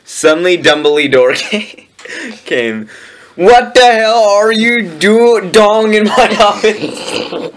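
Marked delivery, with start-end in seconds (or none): 3.4-7.5 high, drawn-out outrage cracking into laughter; hammed-up indignant yell; mock angry shout. A narrator's reading. Reader voices Dumbledore finding intruders in the office, mock angry shout. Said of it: "He began to shoot angrily".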